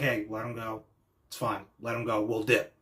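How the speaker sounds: low, quiet, casual brush-off; soft, remorseless dismissal; unconcerned. Retold or imitated. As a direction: imitate someone shrugging it off. Voicing indifferent, remorseless teammates.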